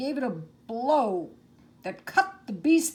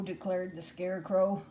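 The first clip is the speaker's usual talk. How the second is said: ordinary delivery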